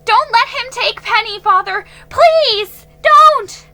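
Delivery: high, loud desperate begging; frantic plea. A narrator's reading. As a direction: beg at the top of her voice. Girl begging father frantically.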